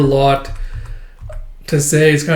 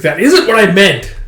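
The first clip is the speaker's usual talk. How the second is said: louder, higher exasperated reply; irritated correction; 6 semitones higher, 6 dB louder than usual. Retold or imitated. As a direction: set them straight, annoyed. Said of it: "And he's like".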